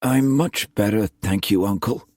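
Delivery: weak, thin voice tailing off. Acted. Pale nephew reassuring his uncle, thin-voiced. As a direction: start high and let it fade thinly. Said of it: "the voice too thin".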